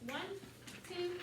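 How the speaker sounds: evenly spaced, measured counting; rhythmic count-in. Shown as a demonstration. Teacher cueing the choir to chant, rhythmic count-in. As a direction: count them in on a steady beat. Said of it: "So chant that with me".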